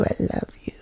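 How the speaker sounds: put-on whispered drunk cooing; mock drunk woman whispering love. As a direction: breathe it like a sloppy kiss.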